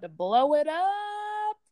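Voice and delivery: hammed-up pitch, building to a big drawn finish; mock sales hype. Imitating a marketing seller on timing, mock hyped.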